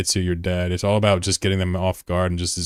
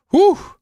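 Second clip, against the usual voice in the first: high, loud relieved whoo; shrill happy yelp of relief, much higher and louder; relieved whoop; 9 dB louder than usual. Real, not acted. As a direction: let out a big whoop of relief. Relief the storm misses him.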